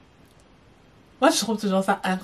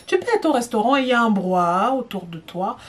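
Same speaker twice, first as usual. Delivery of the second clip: ordinary delivery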